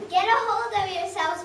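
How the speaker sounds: usual speaking voice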